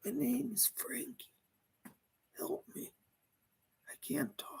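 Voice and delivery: faint breathy whisper through a medium; barely there, struggling to come through; whispering spirit. Pretend.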